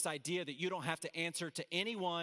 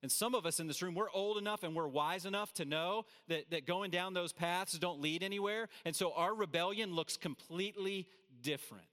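normal talking voice

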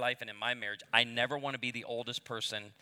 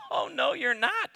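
high, slow laughing refusal; flat no, half laughing; mock defiance; 12 semitones higher, 5 dB louder than usual. Dad refusing older lady making formula, mock defiant. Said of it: "I go".

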